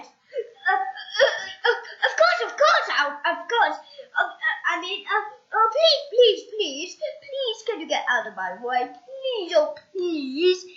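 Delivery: high, loud, giggly pleading; small pleading voice. Acted. Shrunken Mister Appleseed begging the boy to move. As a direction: beg nervously in a tiny voice.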